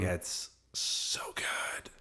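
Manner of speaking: hushed, breathy whisper of delight. Real, not acted. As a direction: whisper it like a secret treat. Savouring office espresso.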